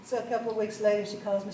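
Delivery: ordinary talk, nothing marked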